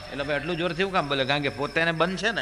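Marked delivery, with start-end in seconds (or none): none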